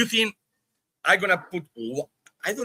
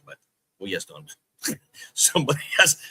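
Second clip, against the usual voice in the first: normal talking voice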